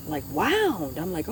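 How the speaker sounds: ordinary talk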